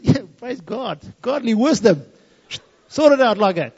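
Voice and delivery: smug satisfaction. Retold or imitated. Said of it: "And I felt good".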